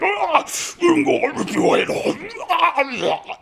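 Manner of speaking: very high, slow, laughing mock voice; hammed-up orc warlord. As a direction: stretch it out, cackling high. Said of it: "he's like".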